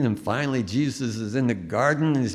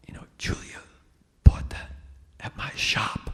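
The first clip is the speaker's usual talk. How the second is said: whispered aside. Retold or imitated. Date whispers gossip at dinner.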